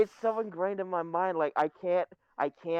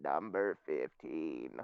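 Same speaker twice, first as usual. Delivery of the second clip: robot voice; 8 dB quieter, slower than usual. Retold or imitated. Meme voice read.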